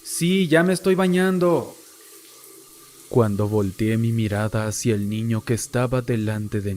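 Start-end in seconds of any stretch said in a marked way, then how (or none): 0.1-1.8 higher-pitched, restrained call; brother calling out. A narrator's reading. Little brother answers from the shower, mild high shout. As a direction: lightly mimic a boy calling back. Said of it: "la voz de mi hermano gritando".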